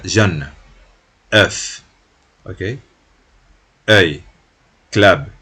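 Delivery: "Jeune", "œuf", "Œil" con EU abierta y enfática; vocal abierta francesa. Shown as a demonstration. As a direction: abre bien la boca en "œuf" y "Œil". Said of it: "un poco más abierta". (ejemplos de la vocal abierta, dichos fuerte)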